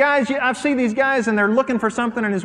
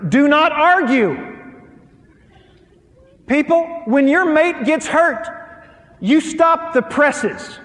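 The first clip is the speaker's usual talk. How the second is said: higher, louder emphatic preaching; preaching at full force; 8 semitones higher, 4 dB louder, slower than usual. Acted. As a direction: drive the point home to the crowd.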